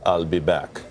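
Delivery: low, imitation Austrian accent. Host imitating the governor in an Austrian accent. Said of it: "I like the way you do that with the accent".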